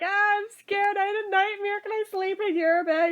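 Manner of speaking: lightly voiced scared little sister; scared little girl. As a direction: play the scared kid begging to sleep in the bed. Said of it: "and she's like".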